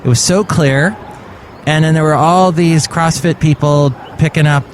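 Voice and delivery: ordinary talk, nothing marked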